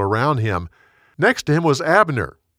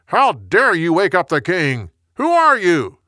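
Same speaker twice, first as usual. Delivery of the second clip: indignant yell, climbing high; yelling back; 5 semitones higher, 4 dB louder than usual. A narrator's reading. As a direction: yell back at whoever woke you. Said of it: "then yelled back".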